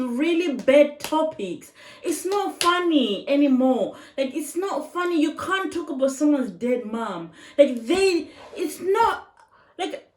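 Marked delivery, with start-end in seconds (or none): none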